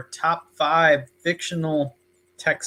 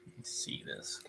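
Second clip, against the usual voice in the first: whisper; 20 dB quieter than usual. Real, not acted. Reaching for the comic, aside.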